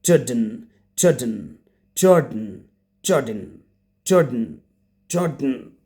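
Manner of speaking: "Jordan" with a reduced ending, JOR-dn; correct pronunciation. Shown as a demonstration. Teacher corrects the students, "Jordan" as JOR-dn. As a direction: swallow the vowel before the n in "Jordan". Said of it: "Without saying an or an".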